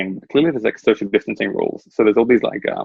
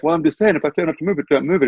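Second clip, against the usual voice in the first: higher-pitched mock insistence; retold arguing; 6 semitones higher, faster than usual. Butcher arguing over a moved rope, mock insistent. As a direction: press a petty point, imitating the butcher.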